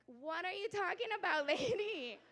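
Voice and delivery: loud, high, laughing disbelief; mock outrage. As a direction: playfully yell at your mother. Mock outrage at her mother.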